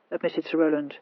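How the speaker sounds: usual speaking voice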